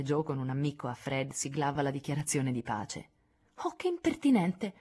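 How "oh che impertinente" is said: a higher, lightly outraged oh; mock-offended exclamation. A narrator's reading. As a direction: pretend shock that means the opposite. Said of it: "esclamò Sally con un'aria che provava il contrario". (finto scandalo lieve)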